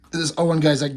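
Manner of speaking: ordinary talk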